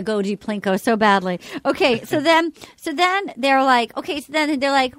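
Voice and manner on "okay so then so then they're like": usual speaking voice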